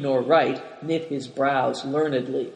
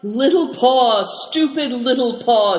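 higher, louder scolding with a touch of amusement; admonishing; 8 semitones higher, 6 dB louder than usual. A narrator's reading. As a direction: chide him, barely hiding a smile. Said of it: "said Dampignac admonishingly".

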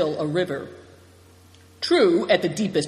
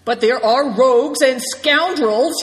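louder, higher burst of anger; sudden shout; 7 semitones higher, 7 dB louder than usual. A narrator's reading. Good Fortune raging about the car thieves, loud shout. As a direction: erupt at the thieves. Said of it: "he shouted suddenly".

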